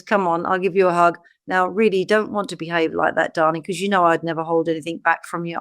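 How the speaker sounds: lightly voiced loving father; tender father. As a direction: soothe the child with a hug. God as comforting father.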